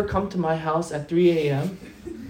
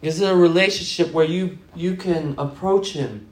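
rising, louder preaching cadence; building sermon; 2 semitones higher, 5 dB louder than usual. Acted. Pastor challenging the congregation, building preaching. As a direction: build the question up each time.

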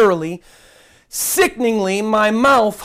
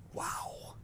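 stunned whispered wow; 25 dB quieter than usual. Real, not acted. Aghast at preacher's words.

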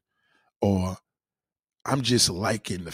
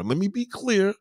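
high insistent; 9 semitones higher than usual. Shown as a demonstration.

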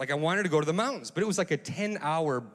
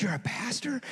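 higher, softer incredulous echo; retold astonishment, pitched up; surprised question; 8 semitones higher, 4 dB quieter than usual. Pilot learns his new acquaintance is a pastor, surprised.

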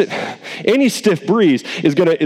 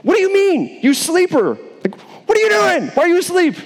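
high, urgent ship captain; high rousing captain voice; the captain scolding; 12 semitones higher than usual. Retold or imitated. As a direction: shake the sleeper awake as the captain. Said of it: "the captain came and said to him".

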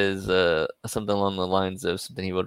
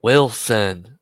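loud imitation of a Kansas City accent; exaggerated Kansas City-style name call; 8 dB louder than usual. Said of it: "that's how he says it".

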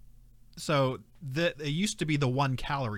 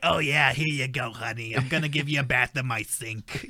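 mock old smoker-lady voice; Grandma Ann. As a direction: play an old lady with a long cigarette. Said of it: "with her, like, cigarette with her long".

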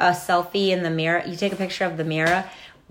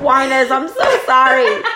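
laughing; 5 semitones higher, 8 dB louder than usual. Real, not acted. Apologizing to listeners through laughter.

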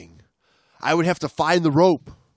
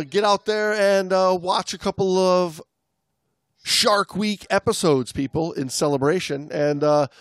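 ordinary talk

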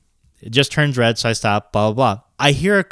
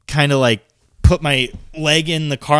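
ordinary talk, nothing marked

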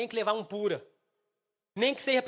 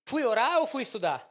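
ordinary delivery

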